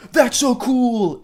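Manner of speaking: high, slow, hammed-up game-character cheer; mock gushing like a canned game line; NPC dialogue. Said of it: "N P C dialogue".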